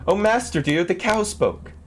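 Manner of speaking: high, breathless alarmed cry; frightened maid. A narrator's reading. Maid running to her master, high frightened cry. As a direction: burst in panicking to the master.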